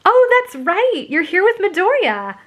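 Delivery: very high, slow-starting gush; excited squeal. A narrator's reading. Friend remembers who the date is, squealing. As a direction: squeal with sudden realization. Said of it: "she squealed".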